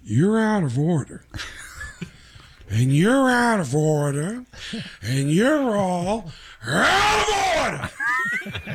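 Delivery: slow, building Pacino rant ending in a shout; Al Pacino impression. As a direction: wind up Pacino style to a big yell. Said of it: "they cut to me as Al Pacino".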